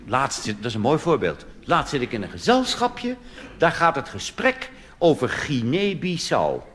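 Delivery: ordinary talk, nothing marked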